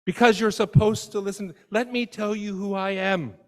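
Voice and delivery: high, loud mock-indignant insistence; indignant spouse. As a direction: insist you must be listened to. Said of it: "you say".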